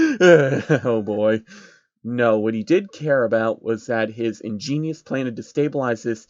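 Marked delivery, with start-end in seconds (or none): 0.1-1.4 chuckling through it; amused real sigh of laughter; laughing. Real, not acted. The reader laughs at the plot, chuckling.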